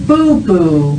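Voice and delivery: Boo-boo with strong lip pops on each b; loud, lips pressed Boo-boo; over enunciating. Shown as a demonstration. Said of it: "Watch my lips this time".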